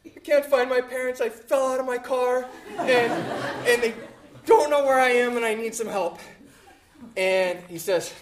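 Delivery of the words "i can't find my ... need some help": put-on high, louder, pleading like a lost crying boy; a lost twelve-year-old pleading with a stranger; crying kid pleading. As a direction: beg for help through the tears. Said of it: "huffing and still crying".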